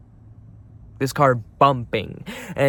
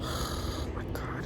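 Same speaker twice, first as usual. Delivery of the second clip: slow whispered exasperation; hushed, sighing despair; weary sigh; 12 dB quieter, slower than usual. Real, not acted. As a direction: sigh it out slowly without voice. Giving up, sighing.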